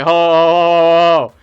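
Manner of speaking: slow, loud drawn-out ho sounds; stunned ho ho. Retold or imitated. Over the rare card, a slow loud stunned ho. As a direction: gasp it out slow and loud, floored.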